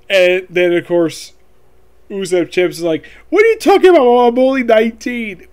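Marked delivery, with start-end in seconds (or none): 3.3-5.5 loud, high-pitched indignant outburst; mock-outraged cry in a raised, high voice; indignant protest. Usopp indignantly protests being called old.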